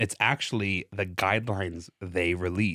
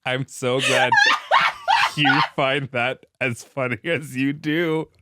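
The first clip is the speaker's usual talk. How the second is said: laughing; 5 semitones higher, 5 dB louder, slower than usual. Real, not acted. Reacts to listener laughing.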